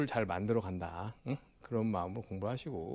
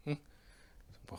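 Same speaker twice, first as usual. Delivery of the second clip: ordinary talk, nothing marked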